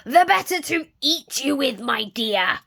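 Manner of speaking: loud, high menacing snarl. Acted. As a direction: snarl it before the pounce. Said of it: "snarled the wolf".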